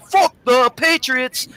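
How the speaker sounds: put-on slow, high Irish accent curse; drawn out Irish accent, relishing each word. Irish fan's first words to him, slow Irish accent. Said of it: "First words out is".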